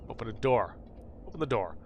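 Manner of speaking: ordinary delivery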